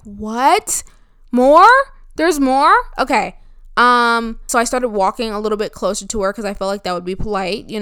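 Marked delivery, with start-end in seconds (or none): 0.0-3.4 startled rising disbelief; surprised. Retold or imitated. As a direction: caught off guard, voice jumping up. Surprised the talk goes on.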